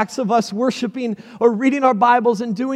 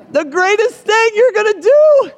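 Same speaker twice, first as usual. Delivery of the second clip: higher and louder, sarcastic; mock incredulous; 9 semitones higher, 3 dB louder than usual. Preacher to congregation, sarcastic disbelief at the plan. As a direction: throw it out in disbelief.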